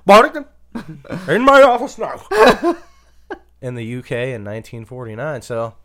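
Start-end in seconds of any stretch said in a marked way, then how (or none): 0.1-2.2 laughing mock boss shout, high and loud; angry boss. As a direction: yell for him like a cranky boss. Boss summoning employee, laughing.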